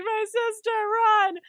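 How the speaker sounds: high, loud cry to flee; mock panicked yell. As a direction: shriek it like a coward running. Junki shouts, fleeing his sister.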